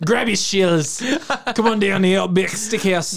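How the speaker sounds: high, drawn-out Australian ad parody; fake Australian accent. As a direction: pitch it like a bad Australian commercial. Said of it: "fake Australian accent from Outback Steakhouse commercials".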